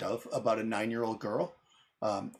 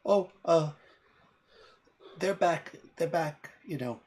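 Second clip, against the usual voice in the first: put-on fey girl voice; 6 semitones higher, slower than usual. Fey girl about her parents.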